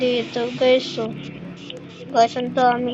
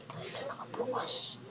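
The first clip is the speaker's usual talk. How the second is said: breathy sneaky whisper; 20 dB quieter than usual. Real, not acted. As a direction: whisper so nobody hears. Sneaking, door open.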